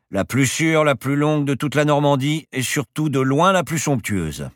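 high, loud, grand sales pitch; proud professor piling on superlatives; boastful pilot. Acted. Pilot boasts about the airstrip.

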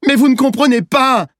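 very high, loud desperate cry; child's shout. A narrator's reading. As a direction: shout as an upset boy. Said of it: "il a crié". (cri aigu d'enfant désespéré)

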